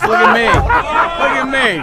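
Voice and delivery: high, slow, pleading; hammed-up sorry-for-myself plea; mock self-pity. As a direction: whine it for attention. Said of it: "I'm like".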